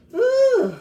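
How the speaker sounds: high, loud exasperated whew. Real, not acted. Reacting to the messy fight with a whew. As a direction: blow out a big whew.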